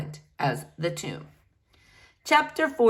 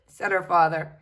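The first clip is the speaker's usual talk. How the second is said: usual speaking voice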